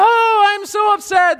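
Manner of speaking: high, loud melodramatic wail; hammed-up upset. Old movie actor ripping his shirt, melodramatic wail. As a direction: overplay the grief like an old movie. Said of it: "when people are upset, they'd rip their shirts off".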